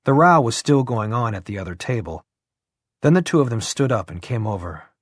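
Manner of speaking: ordinary delivery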